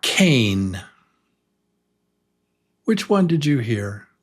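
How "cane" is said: cane said clearly with a long "ay"; careful long A. Shown as a demonstration. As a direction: say cane carefully for a listening test. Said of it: "Listen carefully".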